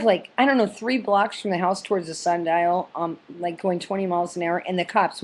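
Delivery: ordinary talk, nothing marked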